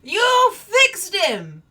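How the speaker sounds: loud, high, slow and overjoyed; delighted shout. Acted. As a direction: bellow it out in happy amazement. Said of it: "which he adjusts and goes".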